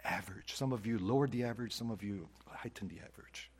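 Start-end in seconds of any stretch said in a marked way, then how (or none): 1.7-3.5 unvoiced whisper tossed off after the joke; whispered aside. Real, not acted. Pastor teasing the congregation in a whispered aside. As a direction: drop to a sly whisper.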